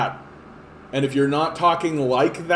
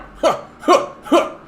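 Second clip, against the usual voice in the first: high laugh; 13 semitones higher, 6 dB louder than usual. Real, not acted. Laughing at own bit.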